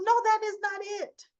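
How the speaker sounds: retold outburst of refusal; emphatic denial. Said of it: "She goes".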